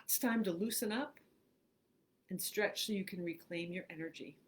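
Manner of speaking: unhurried phrases with long pauses; guided meditation. Acted. Host reads a relaxation script to online listeners, guided meditation. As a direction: guide them through breathing, leaving room after each phrase.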